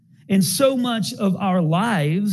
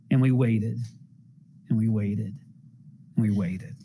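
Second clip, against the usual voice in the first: fading weariness; 6 semitones lower, 6 dB quieter than usual. Retold or imitated. Waiting on the runway, repeated.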